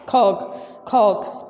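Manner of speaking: कॉक repeated carefully as a model word; proper pronunciation. Shown as a demonstration. Teacher modelling कॉक for students to copy. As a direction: say कॉक slowly twice, with no l. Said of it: "इसकी ये असल pronunciation है".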